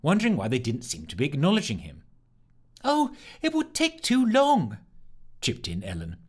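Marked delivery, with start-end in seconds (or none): 2.8-4.8 much higher, slow, flustered; a hint of panic in a high voice. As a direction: fluster through it. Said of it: "beginning to sound flustered".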